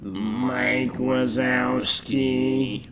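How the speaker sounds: slow, soft creaky clerk voice; Roz voice. Acted. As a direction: drone it like a bored clerk. Monsters Inc quote voice.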